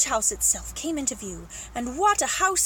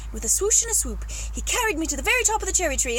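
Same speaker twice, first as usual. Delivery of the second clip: usual speaking voice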